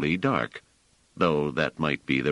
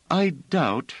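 higher, slow, firm woman's voice; Bliss, firm; 12 semitones higher, 4 dB louder than usual. A narrator's reading. Bliss overruling Treves about stepping out, firm and high. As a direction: lay down the point firmly as Bliss.

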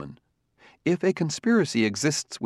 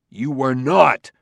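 louder, slow, fierce denial; fierce cry; 2 semitones higher, 6 dB louder, slower than usual. A narrator's reading. Bailey accusing the robot, fierce and loud. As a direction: slam each word down in anger. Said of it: "cried Bailey fiercely".

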